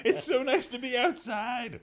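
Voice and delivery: very high, sing-out chirpy voice; mock cheeriness. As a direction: gush in an exaggerated high-pitched voice. Comic character's outward cheerful small talk.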